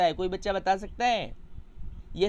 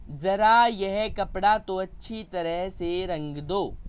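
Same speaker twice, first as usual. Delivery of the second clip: slow demo reading; slower than usual. Shown as a demonstration. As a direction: slowly read out, pausing after the comma. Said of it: "के बाद लगा हुआ है". (धीरे-धीरे पढ़कर दिखाना)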